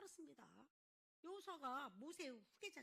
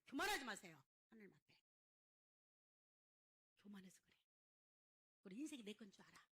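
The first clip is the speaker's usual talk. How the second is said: ordinary delivery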